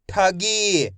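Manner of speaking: ठगी with a long final ee; short ठ, then held गी in ठगी; stretched pronunciation. Shown as a demonstration. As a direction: blend ठगी carefully for learners. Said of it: "मैं इसका खींच के उच्चारण कर रहा हूँ".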